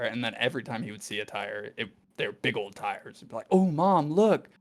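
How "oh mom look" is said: put-on higher, slow, wide-eyed kid voice; little boy. Boy telling his mom about a tire, lightly voiced child. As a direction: point it out like an amazed little kid.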